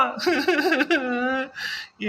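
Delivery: a high, put-on crying wail with no words; fake sob. Wife wails to her husband, a fake sob. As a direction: wail as if weeping over fate. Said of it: "எதுக்கு இப்படி அழுகுற?".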